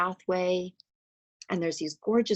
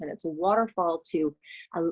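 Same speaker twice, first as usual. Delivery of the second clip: ordinary talk, nothing marked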